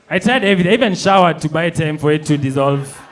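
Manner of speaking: loud, high, frantic account; frantic retelling. Retold or imitated. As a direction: tell it in a panic. Trapped in bathroom, frantic.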